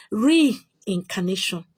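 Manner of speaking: each syllable of Reincarnation drawn out very slowly; slow emphatic repeat. Shown as a demonstration. Telling viewers where to get the handout, very slow and deliberate. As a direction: spell out Reincarnation slowly so it sticks. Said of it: "Get my handout on what?".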